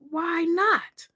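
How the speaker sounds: high, light and defiant; defiant comeback. Retold or imitated. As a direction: toss it back like it's obvious. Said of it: "And I was like".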